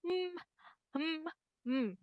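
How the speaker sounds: mm as a held hum with closed lips; mm sound demo. Shown as a demonstration. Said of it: "So it sounds as".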